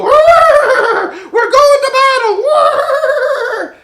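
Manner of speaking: high, slow, stretched-out cry like an eager warhorse; a laughing, whinnying battle call; horse voice. Acted. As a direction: sing it out high and drawn out, thrilled for battle. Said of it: "the horse would go".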